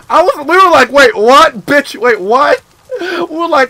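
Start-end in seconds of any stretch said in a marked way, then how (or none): 0.9-2.6 shocked retort. Retold or imitated. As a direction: fire it back, stunned and offended. Reacting to the insult.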